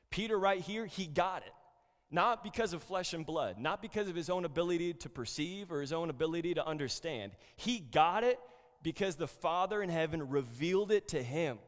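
a preacher getting fired up; fervent preaching. Acted. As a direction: press the point home from the pulpit. Preaching at full force.

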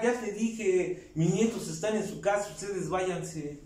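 lento y tajante, cada palabra despacio. Retold or imitated. Abuelo insiste en rechazarlos. (rechazo lento y tajante)

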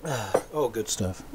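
soft sigh after a sip of tea; mid-sip, a quiet satisfied "ah"; sipping hot tea. Real, not acted. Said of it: "please pardon the slurping".

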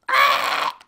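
sudden loud, harsh yelp; startled scream. Real, not acted. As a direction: cry out as if suddenly attacked. Attacked by the spider.

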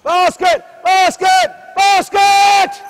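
shouted, high and loud; yelled call. Shown as a demonstration. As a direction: bellow it across the court. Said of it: "You yell at basket".

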